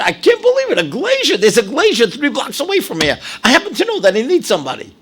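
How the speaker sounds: the good man delighted he can help; excited Mike Tress. Retold or imitated. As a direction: jump up with glad surprise.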